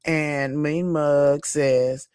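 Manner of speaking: normal talking voice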